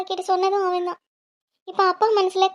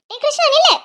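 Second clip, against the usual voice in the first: loud, higher yell of his name, then stop; angry shout; 8 semitones higher, 6 dB louder than usual. Acted. Sister to brother walking away, loud angry yell. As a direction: shout after him to halt.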